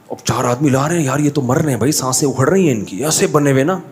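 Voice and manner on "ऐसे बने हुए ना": ordinary talk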